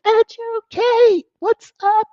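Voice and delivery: high excited hello; excited greeting. Real, not acted. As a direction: shout the greeting with delight.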